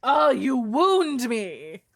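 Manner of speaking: high, loud, drawn-out melodrama; hammed-up hurt, swooping up on "wound"; mock wounded. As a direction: wail it slowly as if mortally offended. Mock hurt at being insulted.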